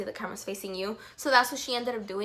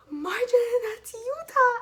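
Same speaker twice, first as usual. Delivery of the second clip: much higher, louder hissed alert; urgent excited alert; 13 semitones higher, 6 dB louder than usual. Retold or imitated. Friend tells Marjorie the idol is right there, high excited. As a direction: grab her attention, starstruck.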